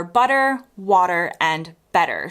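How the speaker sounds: "butter", "water", "better" with T softened towards D; American flapped T. Shown as a demonstration. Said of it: "in my accent".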